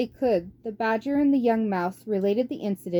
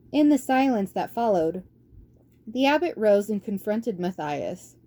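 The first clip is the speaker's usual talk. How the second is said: ordinary delivery, nothing marked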